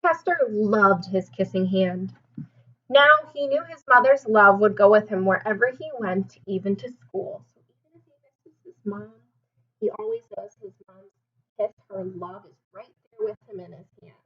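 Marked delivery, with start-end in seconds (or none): none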